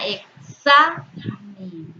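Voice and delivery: "examen" slowly, SA punched and drawn out; stress on penultimate syllable. Shown as a demonstration. Teacher giving an example of graves, SA stressed in "examen". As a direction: split "examen" and hit the SA hard. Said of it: "en la penúltima sílaba".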